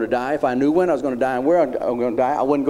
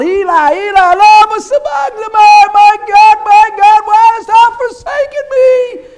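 anguished cry; 10 dB louder than usual. Acted. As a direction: wail it out from the cross. Jesus's cry on the cross.

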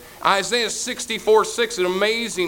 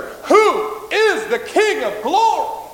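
soaring question; 10 semitones higher, 4 dB louder, much slower than usual. Acted. Preacher's dramatic rhetorical question.

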